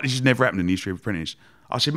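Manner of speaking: normal talking voice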